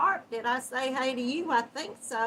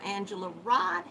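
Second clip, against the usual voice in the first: usual speaking voice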